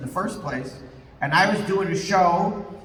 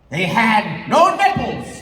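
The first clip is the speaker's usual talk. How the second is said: slow, louder mock gravity; slow grave reveal; 3 semitones higher, 6 dB louder, slower than usual. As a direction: land it like a solemn secret. Setup for punchline.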